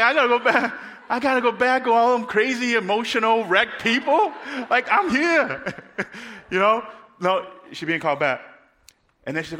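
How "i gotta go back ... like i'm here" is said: high, loud, hammed-up disbelief; comic outraged protest. Girl in heaven told to return, in hammed-up protest. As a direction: whine loudly at being sent back, played for laughs.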